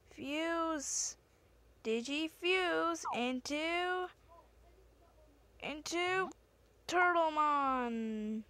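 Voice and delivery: high, slow dramatic fusion call; anime announcer. Pretend. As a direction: call the fusion like a cartoon hero.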